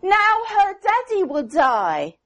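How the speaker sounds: put-on high, distressed child's voice; upset child Mary. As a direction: voice the girl as fear rises. Child Mary upset about doll.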